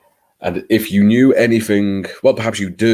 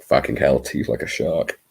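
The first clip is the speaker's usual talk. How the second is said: usual speaking voice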